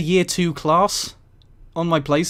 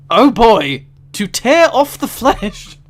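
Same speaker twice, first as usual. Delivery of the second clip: louder and higher, slowed in disbelief; shocked reading; 5 semitones higher, 8 dB louder, slower than usual. Real, not acted. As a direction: read it out, appalled and amused. Reading gruesome phrase book punishments.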